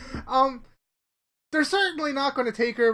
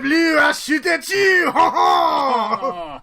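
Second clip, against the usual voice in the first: put-on slow shouted French accent; 5 semitones higher, 8 dB louder, much slower than usual. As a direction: bellow like a cartoon Frenchman. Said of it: "he's like Pepe Le Pew".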